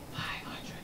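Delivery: hushed, whispered FIVE HUNDRED; whispered repeat. Real, not acted. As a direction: drop to a whisper on the figure. Figure repeated for effect.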